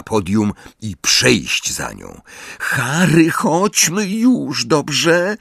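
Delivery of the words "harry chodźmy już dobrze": high, drawn-out pleading; pressing girl's plea; insistent. A narrator's reading. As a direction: urge him along, pitch raised. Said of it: "natarczywy głos Hermiony".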